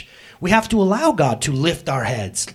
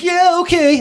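goofy deflection; 12 semitones higher, 7 dB louder, slower than usual. Retold or imitated. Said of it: "some of us wanna goof".

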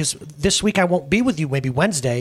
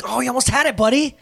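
put-on higher, cheering a kid learning to ride; encouraging; 11 semitones higher, 3 dB louder than usual. Shown as a demonstration. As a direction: coax him on like a proud dad. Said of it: "It's more like".

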